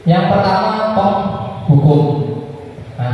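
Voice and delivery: ordinary talk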